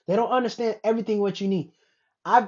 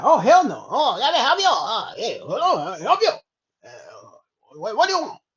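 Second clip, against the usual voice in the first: mock Chinese accent; 6 dB louder than usual. Imitating a Chinatown worker taking an order, mock Chinese accent.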